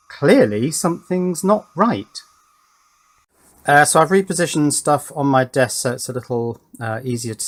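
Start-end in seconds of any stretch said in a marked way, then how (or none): none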